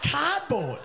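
high, punched-out repeat of CARDBOARD; incredulous mock echo. Comic mocks the lad's job, high and incredulous echo.

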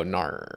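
"Nar" with an R-coloured ending; imitated "nar". Teasing a streamer about their new no, imitated "Nar". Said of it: "but now you go".

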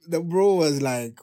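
normal talking voice

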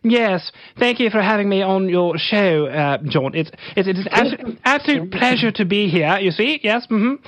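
overly formal English gent; posh British accent. Retold or imitated. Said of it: "like, super British".

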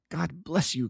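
high, fervent thanks without shouting; passionate cry. A narrator's reading. Prisoner thanking Holmes for hope, passionately. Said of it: "cried the prisoner passionately".

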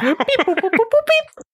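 rising high beeping sounds; dial tone beeps. Retold or imitated. Imitating phone dial tones.

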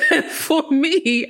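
words squeezed out through a laugh. Real, not acted. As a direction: still laughing as she starts again. Laughing after her own joke.

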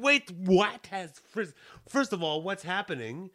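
retold shock; surprised. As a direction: caught off guard by the warning. Shocked at phone cutoff call.

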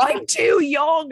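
high, loud, slow whiny protest; mock panic. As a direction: plead it like a panicking kid. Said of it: "So I was like".

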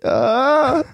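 high, loud mock scream; a short hammed-up yelp; panicked scream. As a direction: shriek in pretend panic. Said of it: "bara skrek och fick panik".

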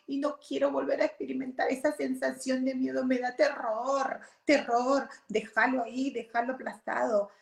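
put-on high, childlike plea to leave it buried; scared inner child. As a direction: voice the terrified child inside. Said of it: "era la niña que decía".

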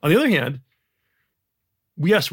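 ordinary delivery

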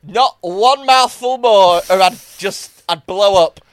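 loud, high, slow groaning; overstuffed diner. Retold or imitated. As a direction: protest being too full. Acts stuffed diner, loud.

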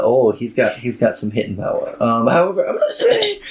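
ordinary talk, nothing marked

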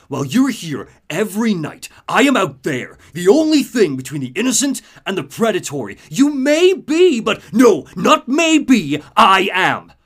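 a hero's rallying speech, rising to a roar; Bruce's defiant speech. Retold or imitated. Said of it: "Bruce breaks it by saying".

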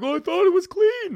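high, loud imitation of an excuse; hammed-up squeal of self-defence; mock defensive protest. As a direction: plead innocence in a put-on high voice. Acting out Braden's defensive excuse.

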